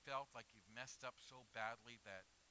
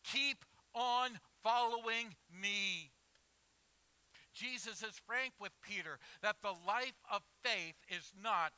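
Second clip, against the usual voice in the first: full-force preaching; 8 semitones higher, 15 dB louder than usual. Acted. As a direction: proclaim it to the whole church. Preaching to the congregation.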